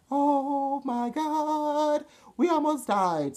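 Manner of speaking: high, loud, long-drawn wail; hammed-up shock. Driver after a really dangerous chase, wailing in shock.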